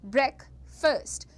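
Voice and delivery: ब्रेकफास्ट as brek, fust, both clipped short. Shown as a demonstration. Teacher showing both parts short, ब्रेकफास्ट as brek-fust. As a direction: say ब्रेकफास्ट in two short halves. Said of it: "दोनों चीज़ों को छोटा छोटा".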